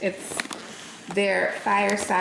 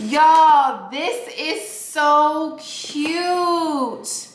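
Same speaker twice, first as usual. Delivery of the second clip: high, loud and drawn out with delight; delighted squeal; 6 semitones higher, 5 dB louder, much slower than usual. Real, not acted. Unboxing a gift box, squealing with delight. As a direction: gush over the cute box.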